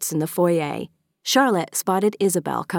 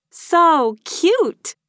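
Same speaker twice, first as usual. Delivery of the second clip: high, loud delighted squeal; excited gushing; 12 semitones higher, 5 dB louder than usual. A narrator's reading. Maeve admires her friend's handiwork, gushing excitedly. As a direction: coo loudly over something adorable. Maeve gushes over decorations.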